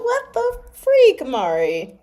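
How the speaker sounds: high, loud, drawn-out dismay; exasperated outburst. Real, not acted. Reader scolding herself over the slip, exasperated. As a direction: cry it out at yourself in disbelief.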